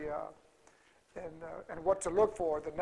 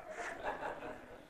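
soft laugh; 13 dB quieter than usual. Real, not acted. Laughs after telling story.

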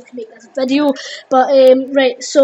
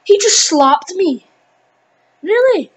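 high, loud, incredulous outburst; real gamer outrage, pitched up; indignant disbelief; 6 semitones higher, 6 dB louder than usual. Real, not acted. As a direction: protest in stretched, rising disbelief. Reacting to being hit in game.

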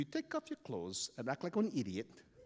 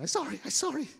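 high, loud pleading; acted panicky apology; groveling; 12 semitones higher, 4 dB louder, faster than usual. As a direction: beg in a frightened kid's voice. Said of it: "I start groveling too".

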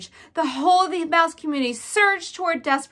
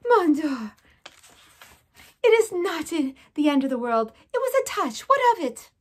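put-on high, French-accented mother mouse; a frantic French mother; mother mouse pleading, high and fretful; mother mouse's voice; 4 semitones higher than usual. A narrator's reading. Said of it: "heard his mother's voice".

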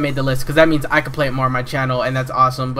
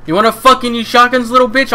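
high, loud mocking taunt; taunting challenge; 9 semitones higher, 6 dB louder than usual. Real, not acted. Gamer taunting a shotgun user, loud challenge. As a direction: taunt the opponent.

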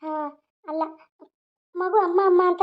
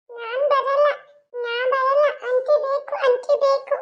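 put-on high, stubborn little-girl whine; whining child; 8 semitones higher, slower than usual. Little girl refuses to leave aunty, high stubborn whine.

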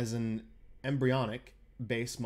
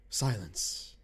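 ordinary talk

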